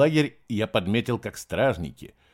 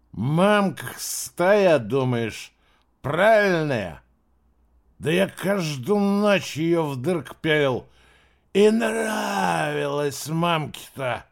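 put-on very slow, higher drunken sneer; spitting drunk; 6 semitones higher, 3 dB louder, much slower than usual. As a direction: spit the filth out, slurring. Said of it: "свои гадости выплёвывал".

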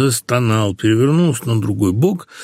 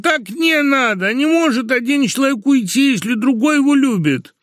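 very high, wailing protest; exclaiming protest; 13 semitones higher than usual. A narrator's reading. Husband begs wife not to leave for another, exclaiming. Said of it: "воскликнул Лоханкин".

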